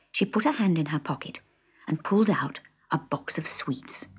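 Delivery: ordinary delivery, nothing marked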